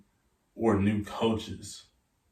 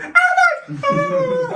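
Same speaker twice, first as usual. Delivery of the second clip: imitating mom's very high drawn-out shriek; mock scream; 8 dB louder, much slower than usual. Player mimicking his mom hearing of the offer, screaming. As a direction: squeal it way up high, loud and stretched. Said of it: "She's screaming".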